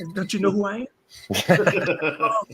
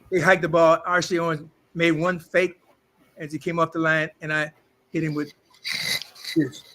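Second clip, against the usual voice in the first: normal talking voice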